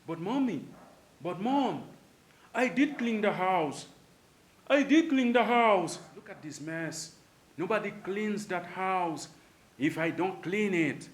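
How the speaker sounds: put-on high, loud insistent kid; protesting child. Child answering mom that the house was cleaned, loud and high. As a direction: insist loudly, high, that you did clean. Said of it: "qué tú le respondes a tu madre".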